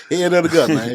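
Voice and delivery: amused loud exclamation; loud laughing outburst. Real, not acted. Laughing exclaim at hard question.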